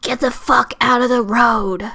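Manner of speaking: raspy smoker passenger impression; cigarette-smoker voice. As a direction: rasp it out like an irritated smoker. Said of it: "I can do that voice".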